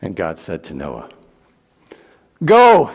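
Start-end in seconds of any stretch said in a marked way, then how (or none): 2.4-2.9 loud, high call; booming command. Acted. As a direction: call it out with full force. God commands Noah out.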